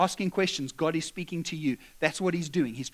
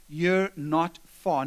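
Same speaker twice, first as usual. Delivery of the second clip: slow emphasis; much slower than usual. Acted. Jesus' words drawn out.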